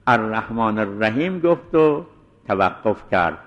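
ordinary talk

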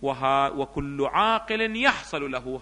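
slow reading with long drawn-out vowels; textbook recitation. Acted. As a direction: recite the text slowly, almost chanting. Reads the textbook aloud. (قراءة بطيئة ممدودة للمتن)

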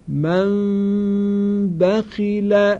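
من بخیل very slowly, the m barely closed, with a long nasal hum; hidden nasal m. Shown as a demonstration. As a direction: chant من بخیل with the m hidden and nasalized for two beats. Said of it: "توجه کنید".

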